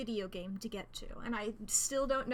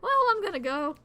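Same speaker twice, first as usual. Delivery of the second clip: huffy exit; 7 semitones higher, 7 dB louder, faster than usual. Acted. Commander leaving, high and loud.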